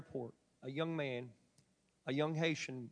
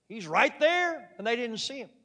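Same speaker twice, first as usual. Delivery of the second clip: 1 emphatic preaching; 6 semitones higher, 9 dB louder than usual. Real, not acted. Preacher stresses Jesus unseen.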